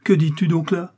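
loud, high protest; indignant cry. A narrator's reading. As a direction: exclaim in shocked denial. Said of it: "s'écria D'Artagnan".

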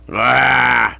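loud gruff growled grunt. Acted. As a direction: bark it out like a cross constable. Constable grunts before spitting.

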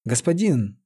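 ordinary delivery

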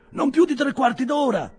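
high, loud reply; shouted answer. A narrator's reading. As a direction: call back over a crowd. Crowd answers the shouted question.

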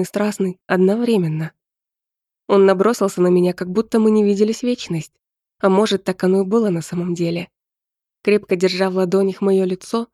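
ordinary delivery, nothing marked

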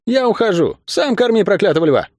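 high-pitched defiant outburst; angry cry. A narrator's reading. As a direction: announce it high and heated, without shouting. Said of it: "крикнула Мари".

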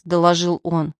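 ordinary delivery

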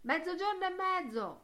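high-pitched call without full volume; distant yell. A narrator's reading. Mulligan yelling the meeting time to Stephen, high and distant. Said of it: "urlò Buck Mulligan".

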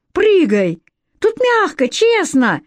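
excited urging that leaps high; eager calling. A narrator's reading. Conner calls Alex to jump into hay, eager. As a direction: coax her to jump.

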